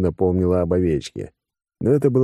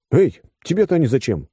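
higher-pitched, puzzled question; surprised; 8 semitones higher, 3 dB louder than usual. A narrator's reading. Man questioning the woman grabbing the crystals, surprised. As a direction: start with a startled «Эй». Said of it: "удивился я".

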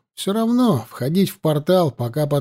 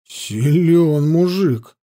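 slow, drawn-out admiration; respectful; much slower than usual. A narrator's reading. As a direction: stretch the words out, impressed. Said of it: "с уважением сказал я". (протяжно, с уважением)

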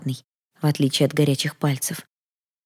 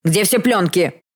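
impatient shouted demand, moderately raised; yelling; 3 semitones higher, 4 dB louder, slower than usual. A narrator's reading. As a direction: demand it roughly of an assistant. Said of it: "заорал он помощнику совсем другим голосом".

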